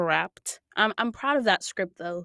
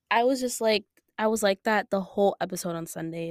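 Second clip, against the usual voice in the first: ordinary talk, nothing marked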